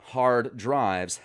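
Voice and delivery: normal talking voice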